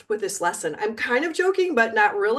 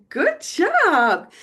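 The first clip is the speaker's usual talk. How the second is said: praise; 7 semitones higher, 5 dB louder than usual. Real, not acted. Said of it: "praise after drill".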